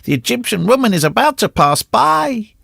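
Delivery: high, wailing warning; crying out. A narrator's reading. Recluse warning of the Egyptian woman, high cry. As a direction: wail the warning, rising far up. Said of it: "she cried".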